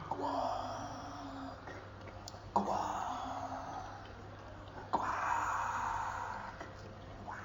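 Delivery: very soft fading quacks; sleepy quacks. Acted. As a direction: let each quack drift off into sleep. Duck falling asleep.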